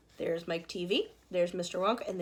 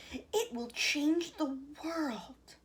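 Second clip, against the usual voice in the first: awed and slow; 6 semitones higher, much slower than usual. A narrator's reading. Grandpa Joe in awe.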